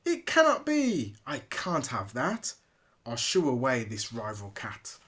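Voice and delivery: cry of outrage. Acted. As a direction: cry it out in disbelief. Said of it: "Kingsley cried out".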